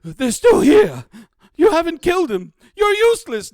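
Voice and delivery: high, loud, horrified cry; panicked guard. Acted. Healed guard accuses his rescuers, loud high panic.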